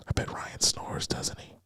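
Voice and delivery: whispered mock confession; mock creepy whisper. Mocking a creepy guy whispering his apology down the phone. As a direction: hush it like a creepy secret. Mock late-night voice message.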